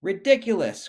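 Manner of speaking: high, loud scoffing cry; indignant old tortoise crying out; cried out. A narrator's reading. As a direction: scoff at the very idea. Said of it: "cried Mister and Missus Tortoise together".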